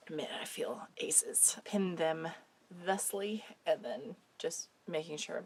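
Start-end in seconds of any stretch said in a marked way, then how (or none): 0.1-1.6 slow, voiceless and much softer; deadpan the joke through a sore throat; wry whispered irony; sarcastic whisper. Real, not acted. Sick, joking about feeling fine.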